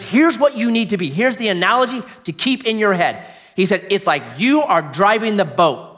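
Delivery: loud, high, urgent preacher's voice; full-force preaching. Real, not acted. Preacher urging dads to keep the boat on course, full-force preaching. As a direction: drive it home to a room of dads.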